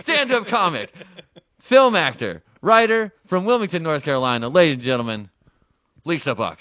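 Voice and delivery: very loud show announcer; big second-take introduction. Acted. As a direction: belt out the guest's credits like an emcee. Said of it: "All right, we'll take two".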